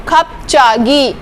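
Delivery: खपचागी slowly, clear ch in the middle; slow pronunciation demo. Shown as a demonstration. Teacher drilling the ch, slow. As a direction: draw out खपचागी syllable by syllable. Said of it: "च की आएगी".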